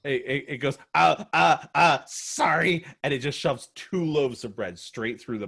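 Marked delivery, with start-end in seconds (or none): 1.0-2.8 flustered goblin. Acted. Goblin cowed by shout.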